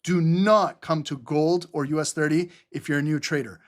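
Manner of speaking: ordinary talk, nothing marked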